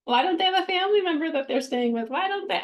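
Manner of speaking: high, fast, pleading questions; anguished wondering, pitched well up; distressed plea. Retold or imitated. Crying in the car at a stranger on the roadside, high fast plea. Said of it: "I'm like".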